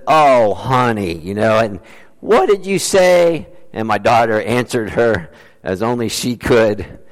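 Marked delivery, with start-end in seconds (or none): 0.1-1.2 slow Southern drawl, lightly imitated; a hint of a Southern optometrist. Optometrist answering the little girl, mild Southern drawl. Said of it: "a real thick southern drawl". 2.2-3.5 high, slow Southern twang of surprise; southern drawl. Retold or imitated. Optometrist asking the girl to repeat, high slow Southern drawl. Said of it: "a real thick southern drawl".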